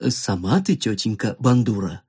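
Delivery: low-pitched talking cello; pleasant baritone. Acted. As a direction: answer back in a deep, measured baritone. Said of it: "произнесла приятным баритоном". (приятным низким баритоном)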